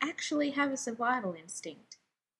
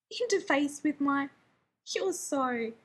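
spluttering; 3 semitones higher, slower than usual. Acted. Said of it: "Rodney spluttered".

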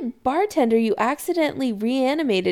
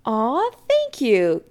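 slow, higher-pitched delight; beaming; 4 semitones higher, slower than usual. A narrator's reading. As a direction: stretch out fond, pleased thanks. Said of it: "Kim beams".

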